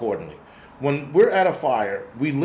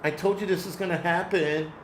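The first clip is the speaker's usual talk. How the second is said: lightly mocked rival's voice, a touch softer; mock feminine voice; 3 semitones higher, 4 dB quieter than usual. Vlogger mocking a rival YouTuber, lightly feminine voice. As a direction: sneer at a rival prepper in a put-on feminine voice. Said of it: "Him and his fucking feminine voice".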